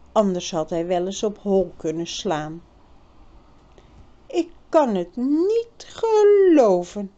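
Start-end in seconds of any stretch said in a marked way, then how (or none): 4.3-7.1 higher, louder stunned cry; girl's voice, dazed disbelief. A narrator's reading. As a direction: repeat it in disbelief. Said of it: "zei Birgit steeds weer".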